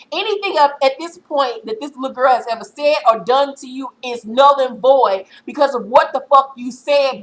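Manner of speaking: fired-up lecture to Jocelyn; scolding rant. Real, not acted. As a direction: scold her like she's in front of you. Speaker lecturing Jocelyn herself.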